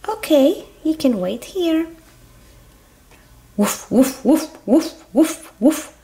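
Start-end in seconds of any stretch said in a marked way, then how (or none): 3.6-5.9 short rising imitated woofs; dog barks. Little doggy asking to board, barking. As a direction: bark like a little dog, in pairs. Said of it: "I am a little doggy".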